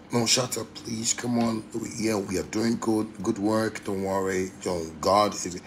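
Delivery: hushed mock-reassuring voice; polite, soft talk. Someone politely calming him down, soft and low. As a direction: soothe softly and low, play-acting politeness. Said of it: "if you talk to me like that, I go talk normal".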